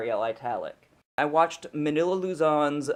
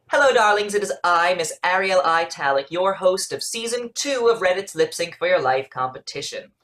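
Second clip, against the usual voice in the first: loud, high host voice with a theatrical flourish; grand diva host greeting her darlings; campy drag queen; 6 semitones higher, 8 dB louder than usual. Acted. Drag host persona intro clip.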